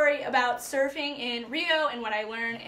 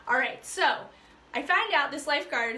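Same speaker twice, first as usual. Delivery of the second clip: ordinary delivery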